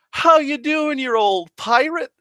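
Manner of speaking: loud, high, hearty greeting; Lando. Retold or imitated. God hugging the player like an old friend, boisterous greeting. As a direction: hail an old friend with mock-boisterous delight. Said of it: "as if it was like Lando when Lando hugged".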